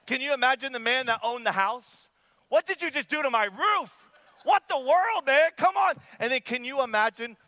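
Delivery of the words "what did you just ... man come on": high, loud, exasperated protest; indignant, high-pitched acted outburst; outraged homeowner. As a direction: yell it like your house was just wrecked.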